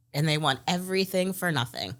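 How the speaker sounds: ordinary delivery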